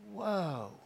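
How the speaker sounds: soft, awed whoa; stunned. Retold or imitated. Churchgoer stunned by his pastor's call, hushed reaction. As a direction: let it out quietly, blown away.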